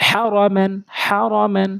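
হা র মান run together without lingering; quick joined reading. Shown as a demonstration. Teacher shows joining three letters, হা র মান read quickly as one. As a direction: read the three syllables of হা র মান straight through. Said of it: "তিনটি দ্রুত পড়ে যেতে হবে".

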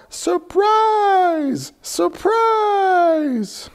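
high excited group yell. Acted. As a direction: shout it with joy. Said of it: "Everyone yelled".

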